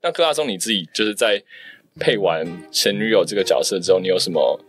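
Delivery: ordinary talk, nothing marked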